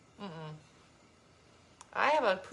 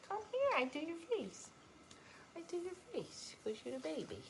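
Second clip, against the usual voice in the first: high soft cooing; 7 semitones higher, 13 dB quieter than usual. Real, not acted. To the favourite pet, soft high baby talk.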